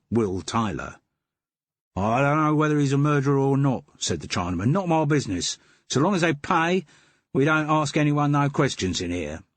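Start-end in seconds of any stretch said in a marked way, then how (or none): 2.0-3.8 a grudging Cockney voice, a touch higher; the den keeper dropping the act for his own Cockney; Cockney twang. Acted. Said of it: "in a voice with a Cockney twang". 4.7-9.4 a grudging Cockney voice, a touch higher; the den keeper dropping the act for his own Cockney; Cockney twang. Acted. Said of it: "in a voice with a Cockney twang".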